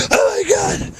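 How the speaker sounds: high startled yelp of oh my god; shocked cry. Real, not acted. Falling into deep snow.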